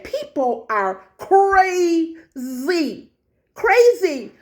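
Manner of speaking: drawn-out, high-pitched CRAZY; incredulous exclamation. Real, not acted. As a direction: exclaim it in amused disbelief, stretching the words. Exclaiming in disbelief at outset.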